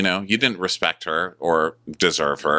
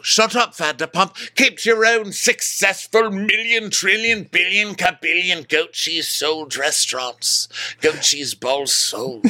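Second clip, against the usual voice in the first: mock snippy retort; 13 semitones higher than usual. Voicing Greg mocking Lisa.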